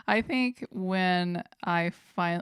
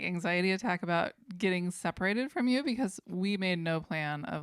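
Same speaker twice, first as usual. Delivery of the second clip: ordinary talk, nothing marked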